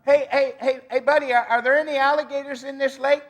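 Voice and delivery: high, loud call across the water; shouting out. Retold or imitated. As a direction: holler at the fisherman on the shore. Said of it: "he shouted out".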